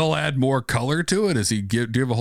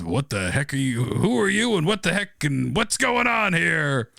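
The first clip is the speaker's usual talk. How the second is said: rising alarmed questions; startled demands; 5 semitones higher than usual. Acted. Mike faces the creatures, shocked and demanding answers. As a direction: blurt out confused questions at the strange creatures.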